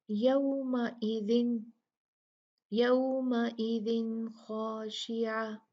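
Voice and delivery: यौमइदिन खाशिया very slowly, with the न said clearly; izhar recitation. Shown as a demonstration. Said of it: "जैसे". (यौमइदिन खाशिया, धीमा, साफ़ न)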